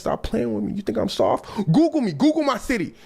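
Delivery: rising outrage about his hometown. Acted. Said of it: "[name] voice can get pretty deep".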